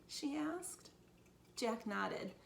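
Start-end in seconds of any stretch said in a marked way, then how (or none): none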